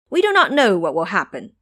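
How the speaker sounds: plain reading voice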